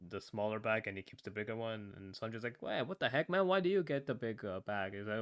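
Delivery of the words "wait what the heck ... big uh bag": higher-pitched, lightly acted complaint; mock protest. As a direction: object as if short-changed. Acted protest of Sanji in retelling.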